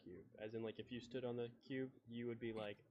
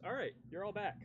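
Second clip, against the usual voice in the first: normal talking voice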